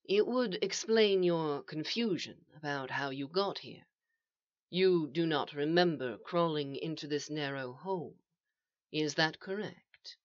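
quiet, measured questioning; soft and calm. A narrator's reading. Calm mysterious voice questions him.

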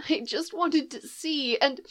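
put-on high, soft tearful girl voice; close to tears. Lost girl explains to Leia, high, soft and tearful. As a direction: voice breaking off as a child. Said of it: "sounding close to tears".

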